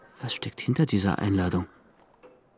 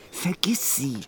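whisper; 5 semitones higher, slower than usual. Acted. Frédéric telling himself to forget.

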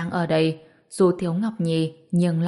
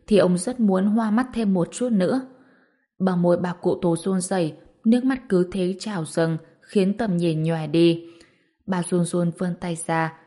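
ordinary delivery, nothing marked

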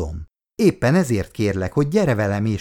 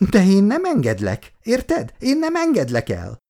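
heated protest; 10 semitones higher than usual. A narrator's reading. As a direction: protest hotly as a mother refusing. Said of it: "vörösre váltan tiltakozott".

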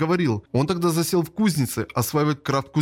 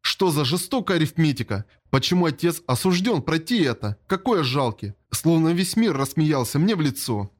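ordinary delivery

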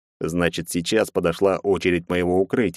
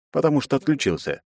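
ordinary delivery, nothing marked

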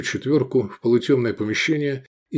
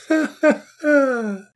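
high, drawn-out acted laugh; merry laugh; much slower than usual. A narrator's reading. Owner amused at the dice game, merry laugh. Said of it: "развеселился Вирий Флаг".

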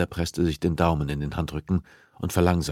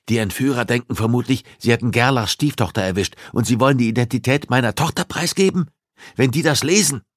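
higher, louder, rising alarm; a father afraid for his daughter; upset father Pulaski; 7 semitones higher, 6 dB louder than usual. Acted.

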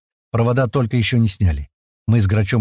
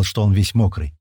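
ordinary delivery, nothing marked

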